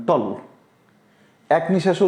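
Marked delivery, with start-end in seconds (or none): 0.1-0.2 "টল" as one unbroken syllable; one breath. Shown as a demonstration. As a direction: say "টল" in a single short push of breath. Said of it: "এক নিঃশ্বাসে উচ্চারণ করতে পারেন". ("টল" এক নিঃশ্বাসে, একবারে উচ্চারণ)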